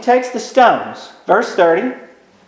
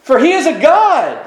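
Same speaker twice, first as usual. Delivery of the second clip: mocking; 6 semitones higher than usual. Elijah taunts the prophets of Baal at noon, mocking. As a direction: goad them in mock encouragement. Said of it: "he mocks them and says".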